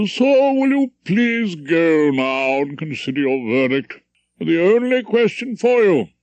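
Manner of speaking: put-on pompous old judge addressing the jury; stern judge. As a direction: lecture the jury from the bench.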